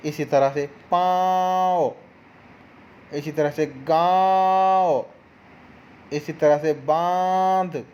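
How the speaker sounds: पाँव, गाँव, बांध said slowly with the nasal vowel drawn out; slow nasal demo. Shown as a demonstration. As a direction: slowly let पाँव, गाँव and बांध ring through the nose. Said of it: "आवाज़ हमारे मुंह से और नाक से".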